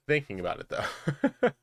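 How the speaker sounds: giggling, with a squeaky rising "though"; laughing. Real, not acted. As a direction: laugh through it, jumping up high at the end. Repeats line laughing, squeaky end.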